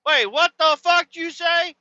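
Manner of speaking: put-on very high, aggressive girl voice; imitating a girl starting a brawl; girl picking a fight. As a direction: squawk it like an offended teen girl. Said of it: "the girls on our side are like".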